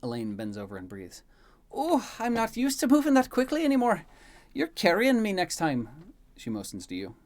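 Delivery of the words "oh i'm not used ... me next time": high, loud exhausted wail; worn-out complaining. Acted. Maid bent over catching breath, loud high complaining. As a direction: wail the complaint, drawing out the end. Elaine huffing, complaining after the rush.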